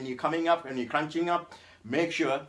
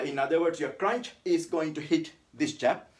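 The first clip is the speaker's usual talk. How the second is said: ordinary talk, nothing marked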